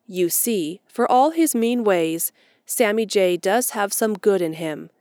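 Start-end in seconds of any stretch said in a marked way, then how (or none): none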